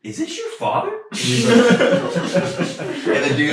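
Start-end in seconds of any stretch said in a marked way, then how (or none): 0.0-0.9 asked much higher in disbelief; incredulous. Retold or imitated. Asking the customer if the caller is his father, high disbelief. 1.2-3.3 loud high burst of laughter. Real, not acted. Friends laughing at the story, loud burst of laughter.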